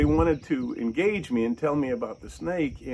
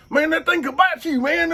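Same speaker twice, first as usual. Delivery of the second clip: very high, loud alarmed cry; freaked-out guy; 11 dB louder than usual. Retold or imitated. Scared men watch brother carry the snake, high alarmed cry. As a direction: squeak it out in disbelief, then laugh. Frightened guys exclaim.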